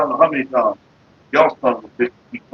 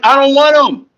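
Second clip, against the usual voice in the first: higher, louder refusal; indignant retort; 10 semitones higher, 4 dB louder than usual. Retold or imitated. Man retells refusing roaches to everyone, indignant and louder. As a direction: push back hard on the idea. Retelling his own reply.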